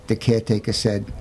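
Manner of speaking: ordinary delivery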